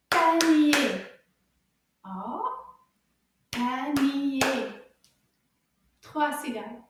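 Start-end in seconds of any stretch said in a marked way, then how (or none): 0.1-1.0 PA-NI-ER hammered out very loud; very loud syllable chant of Panier; counted syllables. Shown as a demonstration. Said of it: "count how many syllables". 3.5-4.4 panier split into loud, even beats; counted syllables. Shown as a demonstration. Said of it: "count how many syllables".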